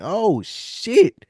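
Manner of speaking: normal talking voice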